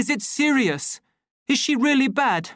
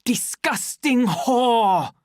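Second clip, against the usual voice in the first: slow disgusted curse; 10 semitones higher, 6 dB louder, much slower than usual. A narrator's reading. Savage pacing in anger.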